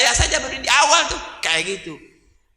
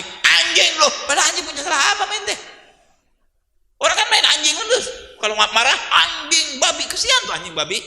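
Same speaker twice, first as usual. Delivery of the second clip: higher, animated, with laughs; excited preaching; 9 semitones higher than usual. Acted. Preacher defending dogs before the crowd, worked up. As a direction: get heated and amused at once. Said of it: "preacher gets worked up".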